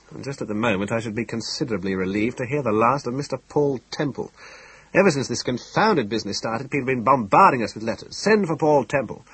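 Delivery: exasperation working up toward fury; mounting irritated rant; impatient, bitter, building. A narrator's reading. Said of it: "impatient and bitter to start with".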